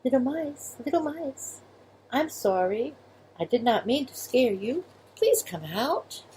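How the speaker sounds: put-on high, soft child's voice; soft little girl. Girl apologising to hidden mice, soft and high. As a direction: speak gently like a sorry little girl. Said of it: "she spoke softly to them".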